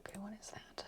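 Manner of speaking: whispered aside to herself; whispering. Real, not acted. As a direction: breathe it under your breath. Muttering to self while searching.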